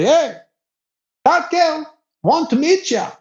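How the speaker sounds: high, eager call. Retold or imitated. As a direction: tip him off like a pushy wingman. Local calls about a girl.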